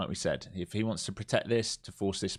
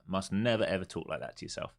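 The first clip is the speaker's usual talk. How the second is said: ordinary talk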